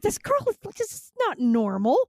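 high, slowing mock disbelief; a child aghast, retold with relish; appalled verdict. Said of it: "go in and read it like that".

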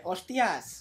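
"Hostias" with marked S sounds; imitated S sounds. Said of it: "cómo habla ahí con, con las S".

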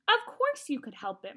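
cross mother's reprimand; scolding. A narrator's reading. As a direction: scold a child, punching it out.

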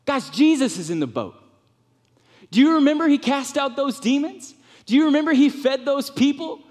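high, loud insistent pleading; fervent reassurance. Pretend. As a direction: shake them out of their fear. Disciple calmly insisting amid panic.

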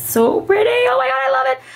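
excited squeal. Real, not acted. Admiring the coin purse her friend sent, high gushing squeal. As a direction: gush over it in a high squeal.

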